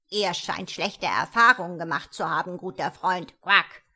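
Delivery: loud jolly frog, ending in a loud croak; a fat frog teasing a friend. A narrator's reading. Frog voiced in story.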